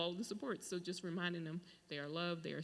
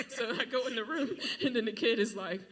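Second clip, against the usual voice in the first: laughing; 5 semitones higher, 11 dB louder than usual. Real, not acted.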